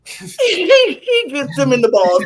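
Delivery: laughing through the words. Real, not acted. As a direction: giggle out the line. Laughing about the low blow.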